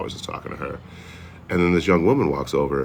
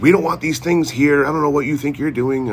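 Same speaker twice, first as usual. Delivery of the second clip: imitating angry owner; 12 semitones higher, 7 dB louder than usual. Angry motel owner confronts him.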